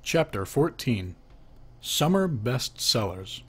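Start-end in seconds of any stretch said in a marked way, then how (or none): none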